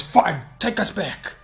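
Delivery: high, drawn-out exasperated imitation; slow, high mock irritation; irritated outburst. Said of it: "he was just so irritated".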